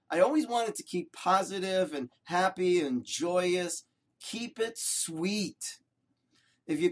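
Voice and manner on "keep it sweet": tender refrain. Acted. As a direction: let the refrain sink in, slowly and gently. Rabbi's refrain, drawn out.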